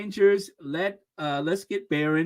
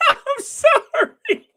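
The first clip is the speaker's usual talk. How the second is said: very high, loud laughing squeal; helpless laughter; 6 dB louder, much slower than usual. Real, not acted. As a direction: squeal it through real laughter. Cracks up over unknown word.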